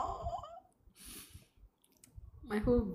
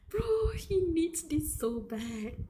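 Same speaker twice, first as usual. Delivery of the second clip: emotional; 9 semitones higher, 3 dB louder, slower than usual. Real, not acted. Emotional reaction to the scene.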